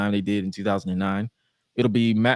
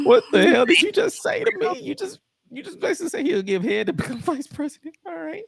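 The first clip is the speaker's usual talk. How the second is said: shocked outrage. Retold or imitated. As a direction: shriek at the innuendo. Speaker's mock outrage.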